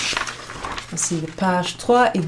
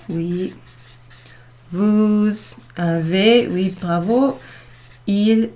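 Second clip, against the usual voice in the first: slow drilled conjugation; slower than usual. Shown as a demonstration. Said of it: "conjugation chant".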